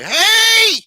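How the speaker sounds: put-on high, shrill-pitched old lady yelling at full volume; grandmother screaming. Grandmother yelling at the cop to leave her grandson alone. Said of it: "here comes my grandmother".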